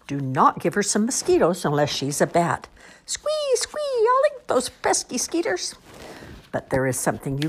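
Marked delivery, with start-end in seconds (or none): 3.0-5.7 very high squeaky bat. Acted.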